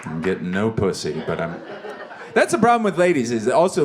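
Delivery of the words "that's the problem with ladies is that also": jumps way up high and louder into the rant; sudden high, loud ranting burst; excited burst. Real, not acted. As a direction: fire off the complaint, pitch leaping.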